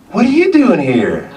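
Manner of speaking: high, loud Southern woman's question; imitated southern accent. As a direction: mimic a Southern girl quizzing someone. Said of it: "she had this southern accent".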